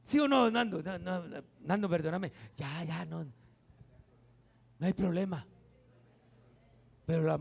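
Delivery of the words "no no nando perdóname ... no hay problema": easygoing forgiveness. Pretend. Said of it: "nosotros los hombres somos muy sencillos".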